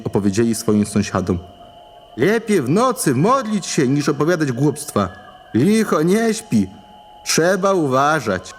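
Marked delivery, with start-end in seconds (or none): 2.2-8.5 slow, higher, grave villager; solemn warning. Acted. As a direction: warn them gravely. Men warn neighbours. (poważne ostrzeżenie chłopów)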